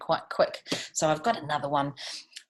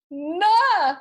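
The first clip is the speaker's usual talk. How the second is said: beginning to cry; 13 semitones higher, 9 dB louder than usual. A narrator's reading. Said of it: "beginning to cry".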